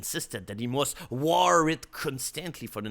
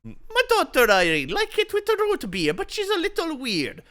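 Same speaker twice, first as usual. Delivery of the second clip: chuckling; 7 dB louder than usual. Acted. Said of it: "chuckled Stevens".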